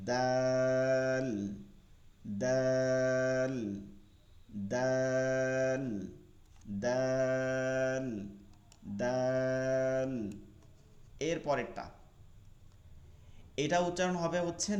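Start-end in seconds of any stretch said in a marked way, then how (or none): 0.1-10.1 দাল stretched very slowly; দাল held long like Bangla দ; drawn-out dal. Shown as a demonstration. Teacher drawing out দাল very slowly for students. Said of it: "এর উচ্চারণ মতন হবে".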